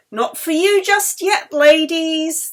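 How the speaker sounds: high, loud jaunty call from a car; driver calling out. Acted. Hearse driver calls out to the two women, loud and jaunty. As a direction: shout it cheekily across the street. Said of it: "the driver called with a wave".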